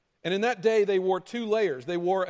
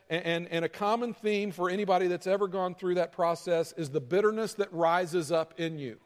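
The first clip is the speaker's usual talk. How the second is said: ordinary delivery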